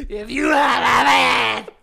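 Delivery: shrieking witch cry, slow. Acted. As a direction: screech it like a sea witch. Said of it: "I said that like a witch".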